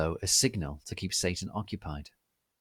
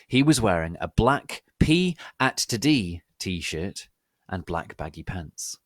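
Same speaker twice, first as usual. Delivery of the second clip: usual speaking voice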